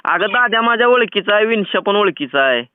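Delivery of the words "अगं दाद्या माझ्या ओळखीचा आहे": ordinary talk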